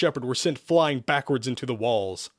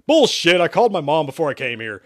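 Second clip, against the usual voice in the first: loud, quick indignant retort; shot back; 4 semitones higher, 6 dB louder than usual. A narrator's reading. Exorcist denying insult about his mom, shot back. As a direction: fire it straight back. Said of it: "Sherman shot back".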